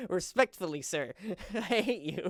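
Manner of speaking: real laughter breaking around mock hatred; laughing through words. Real, not acted. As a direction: laugh as you affectionately protest.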